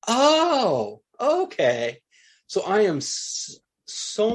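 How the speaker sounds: high, drawn-out "oh" of sudden understanding; acted-out lightbulb moment, high then easing down; dawning realization. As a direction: let the penny drop, starting high and settling. Acts out his moment of realization.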